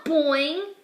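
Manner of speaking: bouncy rising boing; springy boing. A narrator's reading. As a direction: make it spring like a toy. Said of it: "the exclamation point is boing".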